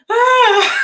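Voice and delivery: loud, high-pitched fake wail; mock crying wail. Wife crying when mad, mock wail. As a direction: burst into a hammed-up cry, half laughing. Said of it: "I cry 'cause I'm like".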